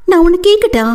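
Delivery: high, sarcastic retort; a hint of scorn; mocking. A narrator's reading. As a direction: tease him back, pitched high. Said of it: "நக்கலாக பார்த்து".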